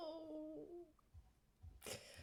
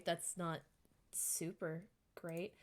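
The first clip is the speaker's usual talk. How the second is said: usual speaking voice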